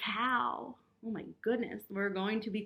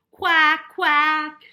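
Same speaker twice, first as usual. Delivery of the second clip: very loud, higher duck quacks; 5 semitones higher, 18 dB louder than usual. A narrator's reading. Puppy George quacking at the vet, very loud. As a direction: honk it like a duck. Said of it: "George went".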